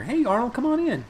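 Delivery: high-pitched beckoning call. Pretend. Host calling Arnold into the show, high welcoming call. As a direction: invite someone in from another room. Calling pretend guest into the room.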